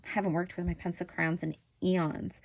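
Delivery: ordinary talk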